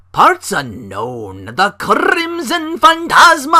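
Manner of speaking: sung-out wrestling announcer call. Retold or imitated. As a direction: belt it out like a hype ring announcer, slowly. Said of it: "this announcer".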